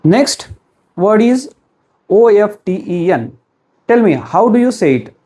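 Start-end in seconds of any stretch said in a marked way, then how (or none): none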